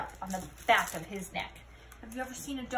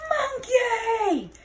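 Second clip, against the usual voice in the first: much higher, loud cry; yell; 11 semitones higher, 7 dB louder than usual. Acted. Scapito yelling on seeing his sock monkey. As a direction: yell it out in dismay. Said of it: "yelled Scapito".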